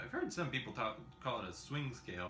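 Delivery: ordinary delivery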